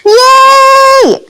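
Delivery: very high, loud squeal of joy; delighted yay. Real, not acted. Player thrilled the creature came close, squealed yay. Creature sits facing her.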